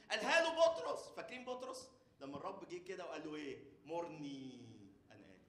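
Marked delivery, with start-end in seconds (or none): none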